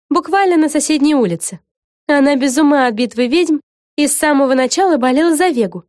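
high-pitched, lively character voice; explaining witch, lively. Acted. A witch tells a friend about Vega's obsessive fan, lively. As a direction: fill them in brightly in a high voice. Figure explains who Lucy is.

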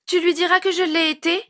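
put-on very high little-boy question; childish concern, high-pitched; worried child. A narrator's reading. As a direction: ask it as an anxious small kid seeking backing. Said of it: "S'inquiéta-t-il auprès de Harry".

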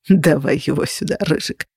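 understated amusement; smirking. A narrator's reading. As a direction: say it low with a faint smirk. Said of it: "усмехнулся Феникс".

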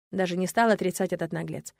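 ordinary delivery, nothing marked

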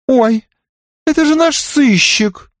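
high gushing cry of delight; delighted exclaim. A narrator's reading. Tipsy Belinda greeting the detective, high gushing delight. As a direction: squeal it with tipsy joy. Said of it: "восторженно воскликнула".

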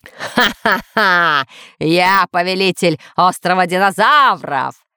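slow villain laugh, then proclamation; theatrical evil-overlord boast; shouting villain. Acted. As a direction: laugh grandly and proclaim your rule. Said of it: "закричал человек в маске".

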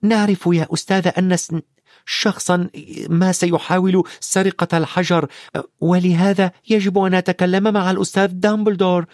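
put-on high-pitched boy's voice, halting at first; earnest high child voice; Harry, explaining. A narrator's reading. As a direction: correct yourself, then press the point in a boyish voice.

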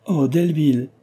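narrated heartfelt outcry; plucking up courage. A narrator's reading. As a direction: cry the name out, summoning courage. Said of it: "s'écria-t-elle en s'armant de courage".

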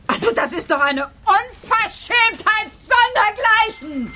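high, slow indignant outburst; outraged. Acted. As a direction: explode in shocked indignation. Outraged at being called unstable.

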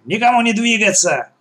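high-pitched freeze order; commanding. A narrator's reading. Gang boss forbids anyone to move, commanding. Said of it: "скомандовал Черногорская".

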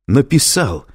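loud, high exclamation; startled outcry, pitch leaping up; exclaiming. A narrator's reading. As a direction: pounce on the word in disbelief. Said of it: "воскликнул Лэнгдон".

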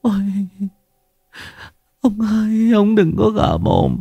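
a wife begging on her knees, sobbing; high, tearful wail of a terrified wife; tearful pleading. Acted. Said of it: "quỳ sụp xuống mếu máo".

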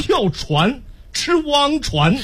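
跳船 said with Henan tones, 船 slow and high; Henan dialect 船. Shown as a demonstration. Correcting the partner that it's 船 not 床, in Henan tones. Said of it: "那不是床吗".

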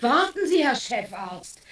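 sharp loud call to stop him. Acted. Mother calls the departing chief doctor back, loud. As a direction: shout to make him wait.

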